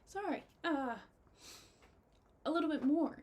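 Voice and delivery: choked-up and fighting back tears; getting emotional. Real, not acted. Thanking her partner for the new set, choked up and tearful. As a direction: voice cracking high with tears. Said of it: "I'm getting emotional".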